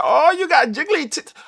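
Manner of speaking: mocking bully. Kids mocking overweight boy, high slow taunt. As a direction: sneer it like a teasing schoolkid. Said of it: "be like".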